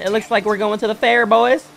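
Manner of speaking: loud, high hype to the crew; hyped announcement. Real, not acted. Hyped announcement, first take.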